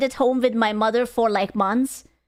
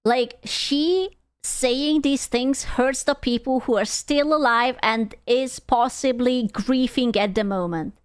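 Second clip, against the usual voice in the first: normal talking voice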